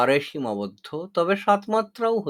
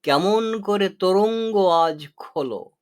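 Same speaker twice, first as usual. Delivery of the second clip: poem recitation; 3 semitones higher, 3 dB louder than usual. Shown as a demonstration. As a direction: read the quoted line as poetry. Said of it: "যেমন ধরুন". (কবিতার মতো আবৃত্তি)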